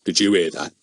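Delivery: usual speaking voice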